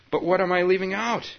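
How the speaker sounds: high, loud and pleading. Acted. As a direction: beg desperately for an answer. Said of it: "I heard myself pleading".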